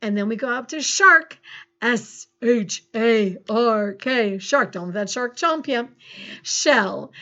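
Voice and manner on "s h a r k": slow, letter by letter; measured, separated letters; spelling aloud. Shown as a demonstration. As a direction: spell it out slowly for a class. Teacher spelling a word aloud.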